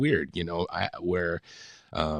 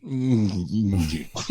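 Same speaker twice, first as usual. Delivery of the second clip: mimicked villainous little humming; playful wordless impression; Doctor Evil; much slower than usual. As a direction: imitate a movie villain's murmured mms. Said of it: "you saw Doctor Evil, and all of us were like".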